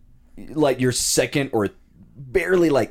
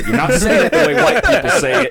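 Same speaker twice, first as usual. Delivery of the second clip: much higher and louder, laughing through it; laughing mock scold; 8 dB louder than usual. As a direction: scold while cracking up. Neighbors mocking the speaker.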